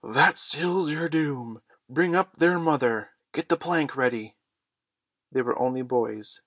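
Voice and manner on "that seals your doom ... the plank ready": loud, raised pirate captain's commands; roaring. A narrator's reading. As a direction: roar orders at the crew, starting drawn out. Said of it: "Hook roared out".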